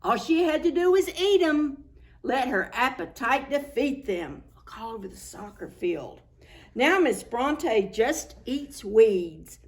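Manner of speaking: usual speaking voice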